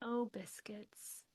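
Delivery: slow hushed whisper of "Oh, biscuits"; a cute whispered oh biscuits. Acted. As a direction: breathe "Oh, biscuits" softly and slowly. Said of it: "You're so cute".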